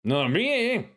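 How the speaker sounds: high, sharp woman's voice; woman cleric voice. Acted. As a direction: voice the cleric scolding him. Cleric talks to the agent.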